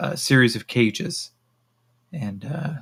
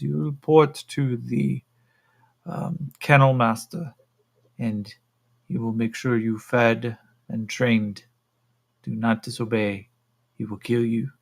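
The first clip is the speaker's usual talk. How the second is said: put-on stern woman with a Russian accent; measured, Russian-accented warning. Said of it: "the Altarians are now Russian".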